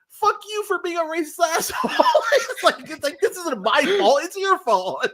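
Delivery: high-pitched outraged rant breaking into laughter; laughing mock anger. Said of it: "it's just me being very, very angry".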